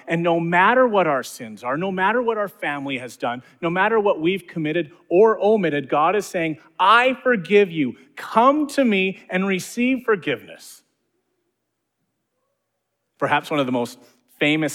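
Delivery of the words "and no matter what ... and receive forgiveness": full-force preaching. Acted. Pastor pressing grace on the congregation, fervent preaching. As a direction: preach it with rising fervor.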